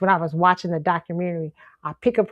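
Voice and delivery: normal talking voice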